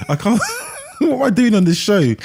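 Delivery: giggling at her own mess; laughing. Real, not acted.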